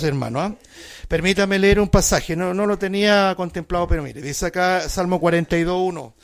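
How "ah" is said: ordinary talk, nothing marked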